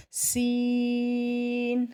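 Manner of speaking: سین drawn long with a hissing whistle on س; whistled seen. Shown as a demonstration. Tajweed teacher demonstrating whistling سین. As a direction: stretch سین and let the س whistle. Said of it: "سیٹی کی آواز آئے گی".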